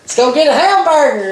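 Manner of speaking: hamburger stretched into a slow Southern hanburner; exaggerated Southern drawl. Retelling Vegas elevator joke, exaggerated Southern drawl. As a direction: ham up the Alabama drawl on hamburger. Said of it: "her and her husband was horse laughing".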